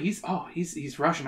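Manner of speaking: plain reading voice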